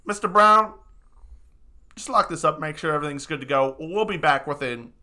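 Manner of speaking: loud, high call to the bear, then quick instructions; wizard giving orders. Acted. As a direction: bark orders at the servant bear.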